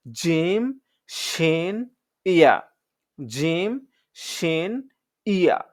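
জিম, শিন, ইয়া said hard from the middle of the tongue; crisp, firm letters; firm jim shin ya. Shown as a demonstration. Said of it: "শক্ত করে উচ্চারণ করব". (শক্ত করে জিম শিন ইয়া)

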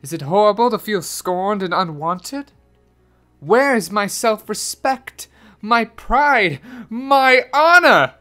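anguished lament building to a high, loud wail; theatrical, mounting distress; unhappy cry. A narrator's reading. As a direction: rise step by step in wounded pride. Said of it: "cried the unhappy Anatole".

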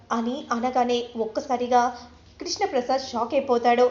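usual speaking voice

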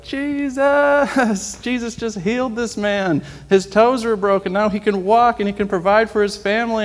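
very high, loud, ecstatic shouting; yelling the news to passers-by, laughing with joy; joyful shout. Retold or imitated. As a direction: proclaim it to the whole street.

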